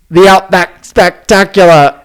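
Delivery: slow, loud Aussie showman voice; Australian accent. Acted. Host slips back into a put-on Australian accent. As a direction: announce the name broad and Australian. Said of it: "I brought this accent".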